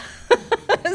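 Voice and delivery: high, loud real laugh; loud amused laugh; big laugh. Real, not acted. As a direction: burst out laughing at the punchline. Laughing at quoted joke.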